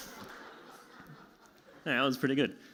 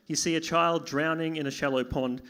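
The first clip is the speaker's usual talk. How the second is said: ordinary delivery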